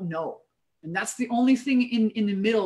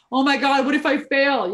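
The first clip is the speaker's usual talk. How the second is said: higher, louder mock panic; mock fearful worry; 4 semitones higher, 4 dB louder than usual. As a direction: act out the anxious voice. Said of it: "It's irrational fear".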